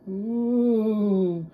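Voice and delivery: high loud frightened ooh; scared children's ooh; kids' scared ooh. Acted. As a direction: kids gasping in fright, high. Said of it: "said Miss Nelson's kids".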